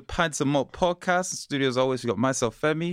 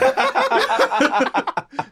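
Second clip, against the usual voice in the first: high laughter; 4 dB louder than usual. Real, not acted. Laughs at own Igbo joke.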